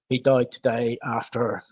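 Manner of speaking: usual speaking voice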